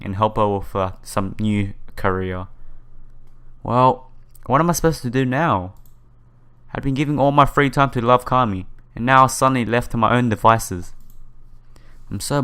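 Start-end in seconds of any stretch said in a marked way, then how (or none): none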